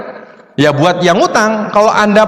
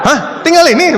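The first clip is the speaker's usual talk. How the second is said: shocked disbelief; 8 semitones higher than usual. Retold or imitated. To the group member, in shocked disbelief. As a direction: sound floored by how little is left.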